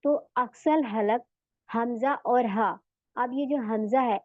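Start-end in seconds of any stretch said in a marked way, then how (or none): none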